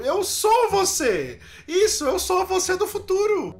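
high-pitched mimicking voice; mock echo of the guest's voice; imitating his guest. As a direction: drag it out high, copying the other one. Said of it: "Tá me imitando?".

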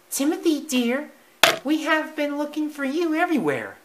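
very high sweet mouse mother. Acted. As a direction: call out fondly, high. Timothy's mother voiced.

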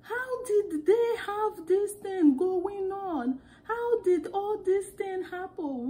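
high, slow, drawn-out exclaiming; exaggerated sister talk. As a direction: wail it slowly, way up high. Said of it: "We were just speaking like that".

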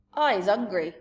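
loud, hungry giant boom. Acted. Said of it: "the giant boomed".

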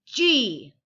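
sudden loud letter call. Acted. Calling out letters in game.

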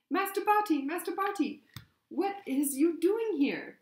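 very high, loud house-elf wail; piercing shriek. A narrator's reading. Winky finds Master Barty on the floor, piercing shriek. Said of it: "she let out a piercing shriek".

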